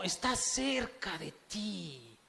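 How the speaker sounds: slow down and lean on each word; slow emphasis. Acted. Slowed emphatic assurance.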